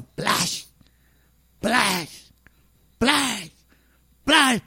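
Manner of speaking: soft plash of each step; rustling footsteps sound; imitated rustle. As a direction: mimic the swish of beads as you walk. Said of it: "Cada paso que daba sonaba".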